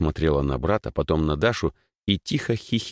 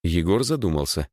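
ordinary delivery

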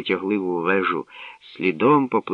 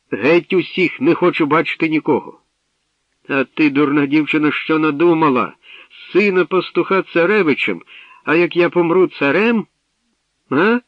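furious scolding; 8 semitones higher, 6 dB louder than usual. Acted. Old king raging at daughter.

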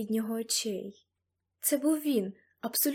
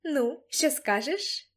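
slow, higher triumphant challenge; 4 semitones higher, much slower than usual. A narrator's reading. Girl turns to face supposed grandfather, triumphant. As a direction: draw it out in triumph, pitched up. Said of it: "Тріумфуючи, я обернулась і сказала".

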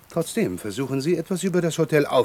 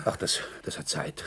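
breathy whisper; 8 dB quieter than usual. Acted. Asthmatic official brushes off cure.

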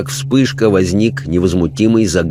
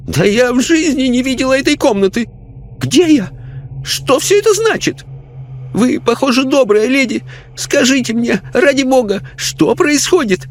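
high, fearful lady's voice; frightened lady. A narrator's reading. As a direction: beg for help, lost and scared.

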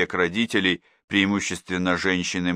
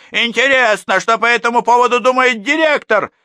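higher, louder pointed question; pointed threat; 8 dB louder than usual. A narrator's reading. Mother threatens the teacher with the principal, pointed. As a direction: threaten with the director.

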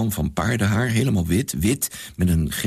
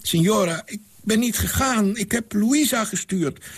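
lightly voiced hoarse old servant; a hint of a croaky old man; 13 semitones higher than usual. A narrator's reading. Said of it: "klonk een hese stem". (lichte hese oude mannenstem)

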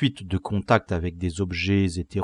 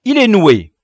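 loud, high exasperated cry; annoyed outcry; 10 semitones higher, 12 dB louder than usual. Acted. Leader finding the lace knotted, loud annoyance. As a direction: complain loudly about the snag.

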